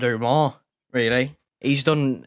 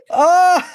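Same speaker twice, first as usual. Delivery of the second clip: high disbelieving laugh with a yelp; helpless laughter; 14 semitones higher, 6 dB louder than usual. Real, not acted. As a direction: burst out laughing. Laughing at botched penalty.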